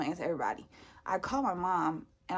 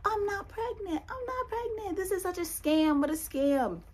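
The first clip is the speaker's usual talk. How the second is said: much higher, drawn-out inner voice; high-pitched exclaiming; 11 semitones higher, slower than usual. Retold or imitated. Thinking the test is a scam, high-pitched exclaiming. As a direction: squeal it slowly, like a silent celebration. Acting out her relieved inner thought.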